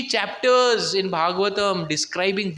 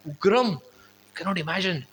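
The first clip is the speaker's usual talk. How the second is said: ordinary talk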